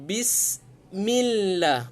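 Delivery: "Bismillah" held out very slowly; slow recitation. Shown as a demonstration. Teacher recites the example slowly. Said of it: "bunyinya B".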